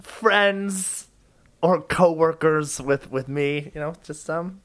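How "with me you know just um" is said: normal talking voice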